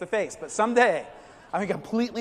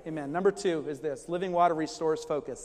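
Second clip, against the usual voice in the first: ordinary talk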